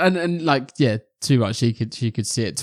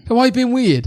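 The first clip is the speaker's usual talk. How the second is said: much higher, lightly voiced suspicious question; a hint of a suspicious teenager; mock puzzled Lucy; 12 semitones higher, 5 dB louder than usual. Lucy questioning him in front of friends, mock puzzled.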